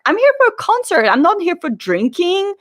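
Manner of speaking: high, quick mock protest; indignant protest. Friend arguing with door staff over ID, indignant.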